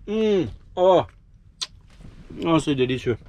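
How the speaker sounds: talking with a mouthful; chewing while talking; mouth full. Real, not acted. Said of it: "Je vais essayer de pas parler la bouche pleine".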